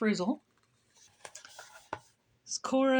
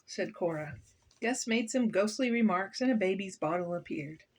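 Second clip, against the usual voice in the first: ordinary delivery, nothing marked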